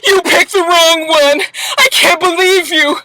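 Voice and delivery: fake-wail it like a crybaby; mock crying. Imitating Amina crying at Peter, mock sobbing. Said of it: "all this crying".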